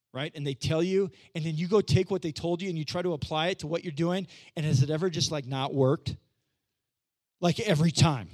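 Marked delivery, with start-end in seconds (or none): none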